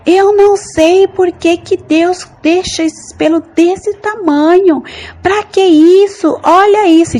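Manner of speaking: high, loud, slow furious complaining; angry rant. Retold or imitated. In a dream, complaining to God about her leg hair, angry high shout. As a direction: rage at the hair like it offends you. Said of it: "muito nervosa".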